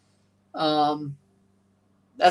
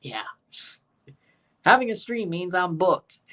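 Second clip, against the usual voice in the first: ordinary talk, nothing marked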